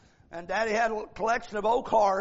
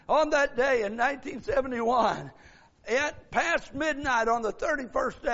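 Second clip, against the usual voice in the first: forceful sermon delivery, hammering each phrase; preaching; 5 semitones higher, 4 dB louder than usual. Real, not acted. Preacher tells congregation God hears their cry, preaching. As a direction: drive the message home to the whole church.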